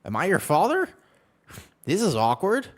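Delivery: high, loud scandalized protest; mock shocked. Streamer reacting to being called daddy, loud mock shock. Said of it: "I'm like".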